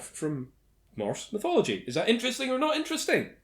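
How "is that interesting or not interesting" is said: very fast, high quiz catchphrase. Acted. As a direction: rattle off the catchphrase.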